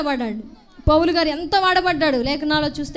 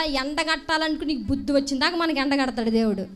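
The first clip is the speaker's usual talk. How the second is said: normal talking voice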